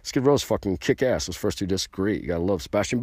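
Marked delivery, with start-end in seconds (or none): none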